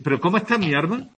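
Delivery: arma said with r for alma. Shown as a demonstration. Teacher showing Sevillian l-r confusion. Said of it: "Mi alma".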